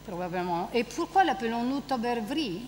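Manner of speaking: quiet dramatic reading; reading a play scene. Acted. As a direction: read the character's lines to the class.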